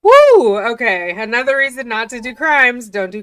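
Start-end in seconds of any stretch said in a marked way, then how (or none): 0.0-0.6 high, loud whoop of shock; loud woo. Real, not acted. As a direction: let out a big shocked whoop. Reacting to hostile log entry.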